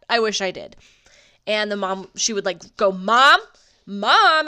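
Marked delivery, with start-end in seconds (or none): 2.9-4.5 daughter calling loudly for mom. Retold or imitated. Daughter shouting for her mom across the store, loud. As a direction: call across the store, louder. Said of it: "and call for her mom".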